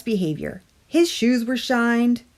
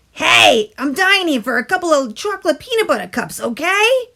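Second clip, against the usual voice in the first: louder, higher, fast pushy demand; 9 semitones higher, 7 dB louder than usual. A narrator's reading. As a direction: bark it out like a greedy craving. Tooth demands candy loudly.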